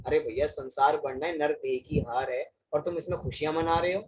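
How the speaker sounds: usual speaking voice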